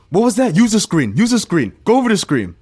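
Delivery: high, loud barking orders; angry shout. Retold or imitated. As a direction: yell at him to use the screen. Josh shouting at teammate.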